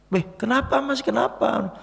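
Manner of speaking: high, concerned man asking; surprised car owner. Retold or imitated. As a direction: ask him like a startled stranger. Car owner asking what happened. (pemilik mobil kaget, nada tinggi)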